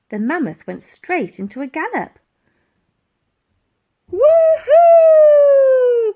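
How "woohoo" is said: very loud, high whoop; girl whooping as they charge down the stairs; cried out. A narrator's reading. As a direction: whoop with joy. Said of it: "cried Elsie".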